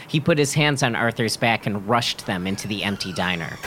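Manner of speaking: plain reading voice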